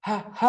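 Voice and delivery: short, puffed "ഹ", like panting; "ഹ" as a quick burst of breath; panting h sound. Shown as a demonstration. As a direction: puff out "ഹ" as a breathy phonics sound. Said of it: "കിതയ്ക്കുന്ന പോലെ".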